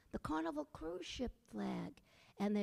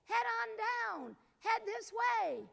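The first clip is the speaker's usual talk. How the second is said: higher, slow drawn-out call; 9 semitones higher, 3 dB louder, slower than usual. A narrator's reading. Jack calls up the chimney to the head, slow and high. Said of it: "he called up".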